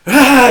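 loud, high mock crowd roar; imitated crowd scream. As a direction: burst out like jeering fans erupting. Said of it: "everybody's screaming at him again".

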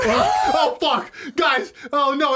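high, loud laughing exclamations; laughing, dropping the act. Real, not acted. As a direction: caught out, cracking up. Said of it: "only way you could get through".

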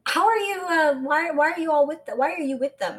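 ordinary talk

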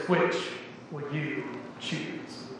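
low, very soft, slow closing question; hushed climax. A narrator's reading. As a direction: drop right down and leave space between the words. Parable's climactic question, after long pause.

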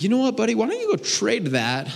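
put-on high-pitched talking-to-a-child voice; coaxing dad. Dad nudges his young son toward a trade, coaxing. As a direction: pitch it up, suggesting a plan to a little kid.

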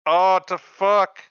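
a loud, slow incredulous groan; stunned disbelief. Retold or imitated. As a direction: stretch each word out in disbelief. Reacting to the show's family meeting.

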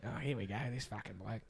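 put-on raspy old man voice. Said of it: "funniest little raspy old voice".

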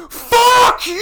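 as loud as possible, very high; all-out yell. Retold or imitated. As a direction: scream it across the whole tent. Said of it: "As loud as I possibly fucking could".

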